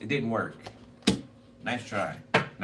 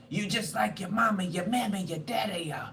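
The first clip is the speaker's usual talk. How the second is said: higher-pitched nagging granny rant; mock ranting grandma; 8 semitones higher than usual. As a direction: imitate the cussing grandma. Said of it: "cussing out everybody".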